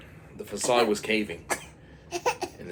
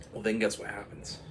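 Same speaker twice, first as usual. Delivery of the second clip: plain reading voice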